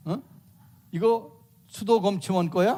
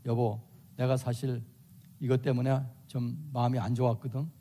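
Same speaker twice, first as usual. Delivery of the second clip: lower, softer calm voice; a wife calmly raising a complaint, lower and softer; 6 semitones lower, 5 dB quieter than usual. Acted. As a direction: ask your husband gently, without heat. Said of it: "이렇게 말로".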